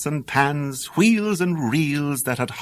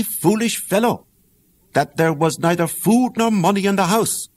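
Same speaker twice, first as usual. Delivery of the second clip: plain reading voice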